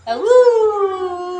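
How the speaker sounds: drawn-out playful wolf howl. Pretend. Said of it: "Are you a baby wolf?". (mahabang pakunwaring alulong ng lobo)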